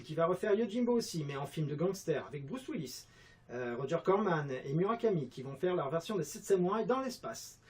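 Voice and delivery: ordinary delivery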